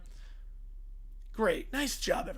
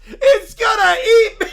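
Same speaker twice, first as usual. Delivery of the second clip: terrified shriek; 6 semitones higher, 13 dB louder than usual. Acted. Terrified of fridge.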